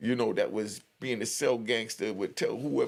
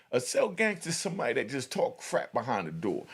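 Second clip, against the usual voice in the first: usual speaking voice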